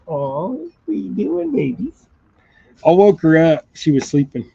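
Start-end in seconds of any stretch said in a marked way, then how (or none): 0.1-2.0 high, slow cooing. Real, not acted. Owner cooing at his sleepy dogs, high and slow. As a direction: coo at the pups.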